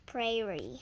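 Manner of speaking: usual speaking voice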